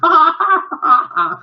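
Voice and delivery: high mock cackle; kids bursting out laughing. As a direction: erupt like a hall of children. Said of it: "the kids burst out laughing".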